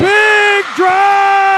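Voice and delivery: big, high, drawn-out shout; excited play-by-play shout. Real, not acted. Calls a big point in play.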